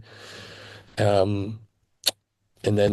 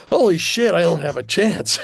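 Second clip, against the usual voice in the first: much higher, a touch of awed alarm; retold dismay; 6 semitones higher than usual. Auditioning kid sizing up his rival, higher retold dismay. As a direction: replay the moment you knew you were outclassed. Retells panic at the competition.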